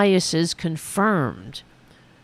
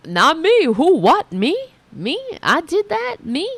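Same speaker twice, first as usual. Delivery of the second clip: high, louder, mock-innocent protests; mock denial; 8 semitones higher, 3 dB louder than usual. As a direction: play the voter who blames nobody but others. Acting out voters denying blame.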